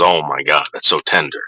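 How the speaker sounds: low, savouring father voice; deep, relishing mock dad; imitating dad. Son mimics dad tasting the tender meatball, low and savouring.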